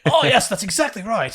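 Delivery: high-pitched put-on British accent; mock British accent. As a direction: play an eager Brit, pitched well up. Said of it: "Do a British accent".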